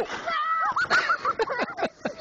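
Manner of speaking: high shrieking laugh while sledding; whooping laugh. Real, not acted. Dad laughs on the sled.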